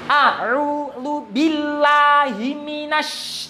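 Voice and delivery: Quran recitation. Shown as a demonstration. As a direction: stretch each syllable like a reciter. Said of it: "Kita praktekkan".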